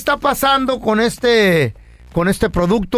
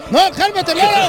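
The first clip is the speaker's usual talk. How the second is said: frantic customer; 9 semitones higher than usual. Acted. Panicked customer calling shop.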